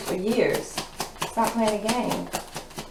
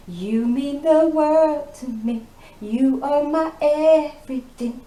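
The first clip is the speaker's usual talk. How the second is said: high, slow and louder, syrupy; mock sweet talk; 8 semitones higher, 6 dB louder, slower than usual. Reader mocking the masculine's sweet promises, hammed up high. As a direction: ham up a lover's pleading.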